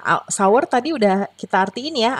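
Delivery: usual speaking voice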